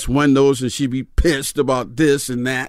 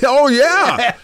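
laughing; 11 semitones higher, 3 dB louder than usual. Real, not acted. Laughing reply.

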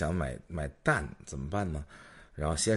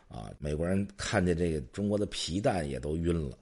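normal talking voice

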